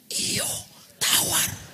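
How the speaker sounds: hushed, voiceless confirmation; acted whisper, keeping it secret; whispered reply. Village head secretly confirming to pastor, whispered.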